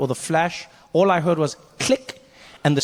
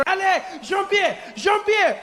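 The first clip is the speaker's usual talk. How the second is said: high, hammed-up French cries with long vowels; panicked French man; 11 semitones higher, slower than usual. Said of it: "The French guy was my favorite".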